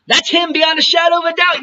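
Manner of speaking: high, sneering mock of the witness; mocking repeat. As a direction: throw the witness's words back with scorn.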